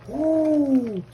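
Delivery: high, loud "woo"; excited whoop. Real, not acted. Cook smelling his finished dish, high excited whoop. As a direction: let out a long high whoop.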